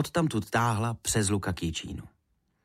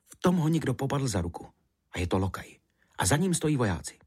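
ordinary delivery, nothing marked

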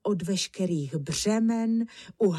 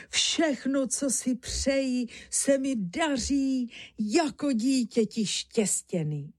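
higher, happy proclaiming; joyful exclamation; 5 semitones higher than usual. A narrator's reading. Honza counting himself fortune's child, joyful exclamation. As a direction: marvel aloud at your good luck.

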